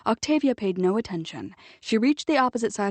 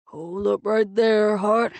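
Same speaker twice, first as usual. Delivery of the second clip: put-on gruff old man; 4 dB louder, slower than usual. Old Mitchell blocks Nick's path.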